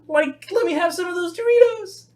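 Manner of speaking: very high cartoon stoner voice; Shaggy. Showing off for girl eating Doritos, Shaggy voice. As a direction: plead in a cracking slacker falsetto. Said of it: "You do Shaggy? Let me hear it".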